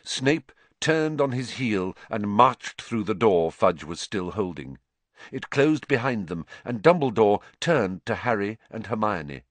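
ordinary delivery

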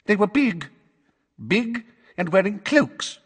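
high, quavery witness voice; Missus Figg. Acted. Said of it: "in her quavery voice".

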